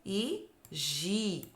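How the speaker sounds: "Gui e gi" very slow and soft; slow contrast. Shown as a demonstration. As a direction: stretch out "Gui e gi" to contrast them. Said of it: "Olha a diferença".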